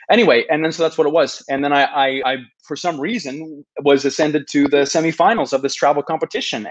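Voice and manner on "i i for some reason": ordinary delivery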